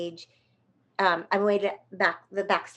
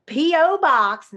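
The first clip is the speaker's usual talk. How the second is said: slow, loud, spelled out; call and response; 7 semitones higher, 7 dB louder, slower than usual. Acted. Myrtle drilling the audience, slow call-out. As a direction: drill it for the crowd to repeat. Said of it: "say it with me".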